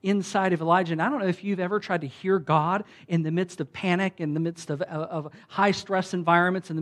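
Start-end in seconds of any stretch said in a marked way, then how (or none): none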